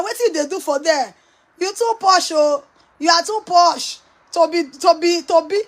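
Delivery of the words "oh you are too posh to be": normal talking voice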